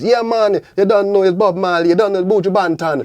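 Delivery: loud, high Jamaican patter; Jamaican accent. Retold or imitated. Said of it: "talk like Jamaicans".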